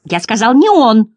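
loud, exasperated correction; Bastian half angry. A narrator's reading. Boy correcting the clowns, loud half-angry cry. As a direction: raise the voice, half cross, half amused. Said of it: "кричал Бастиан, наполовину сердясь, наполовину смеясь".

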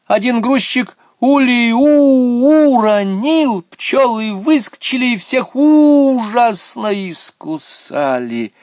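very high, loud, drawn-out wail; hammed-up high cry of calamity; wailing shout. A narrator's reading. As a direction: wail the disaster, stretching the vowels. Girl cries out the disaster.

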